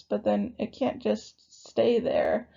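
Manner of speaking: breathy, whispered voice giving out. Real, not acted. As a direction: voice drops to a whisper. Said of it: "I'm losing my voice".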